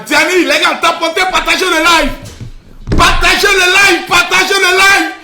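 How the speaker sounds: high, loud live-stream hype; hype shouting. Real, not acted. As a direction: whip up the viewers. (le streamer hurle pour ses spectateurs)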